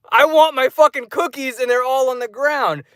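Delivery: high, whiny indignant demand; annoyed. Retold or imitated. Moviegoer demands his cookies back from seat thieves, indignant. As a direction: act out an annoyed protest way up high. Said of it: "I was a little fucking annoyed".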